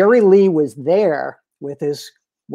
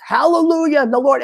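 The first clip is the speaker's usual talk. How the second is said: mock preacher crying hallelujah, pitched higher; a hammed-up revival preacher calling out praise; gospel evangelist; 7 semitones higher than usual. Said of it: "does this gospel evangelist".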